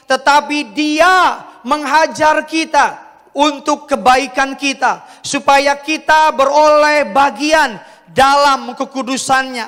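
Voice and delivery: slow, high, emphatic Bible reading; scripture reading. Acted. Pastor reading Hebrews aloud to the congregation, emphatic scripture reading. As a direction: proclaim the verse slowly to a congregation.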